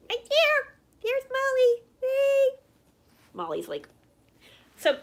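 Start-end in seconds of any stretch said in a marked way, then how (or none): 0.1-2.6 much higher and louder sing-song pet voice. Real, not acted. Talking to her dog Molly.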